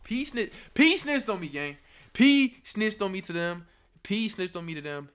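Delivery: high, very loud repeated accusation; outraged shouting. Real, not acted. Storyteller outraged at being snitched on.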